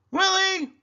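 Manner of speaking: high, loud call across the house; loud sing-song call for a child. A narrator's reading. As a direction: call him from the kitchen. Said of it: "Uncle Bill called".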